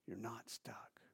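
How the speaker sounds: soft whispered reassurance. Real, not acted. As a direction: drop to a hushed whisper. Sermon aside, hushed reassurance.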